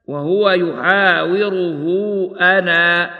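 slow recitation, أنا ending on a long alif; stop on ana with alif. Shown as a demonstration. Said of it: "هكذا".

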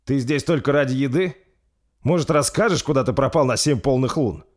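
high, loud demanding questions; angry. Acted. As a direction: demand answers in anger. King demands answers angrily.

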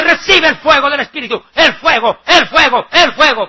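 loud, high parody of a revival preacher; mock crazed shouting. Prosperity preachers handing out holy fire, mock shouting. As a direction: punch each repeat as a mimicked yell. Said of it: "Y gritan como locos".

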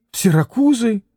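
a mild startled question; bewildered cry. A narrator's reading. Captain hearing the doctor's advice, mild bewildered cry. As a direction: ask it in disbelief, mildly. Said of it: "вскричал штабс-капитан".

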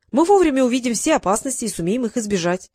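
ordinary delivery, nothing marked

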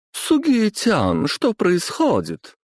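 put-on drawn-out high girlish whine; girlfriend voice. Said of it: "услышал я голос девушки этого мажора".

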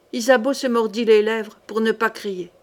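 usual speaking voice

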